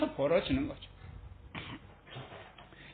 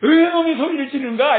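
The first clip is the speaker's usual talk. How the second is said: higher, louder indignant retort; mock yell back; 5 semitones higher, 8 dB louder than usual. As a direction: snap back at the shouting crowd. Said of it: "이렇게 하지 않고". (되받아 소리치는 흉내)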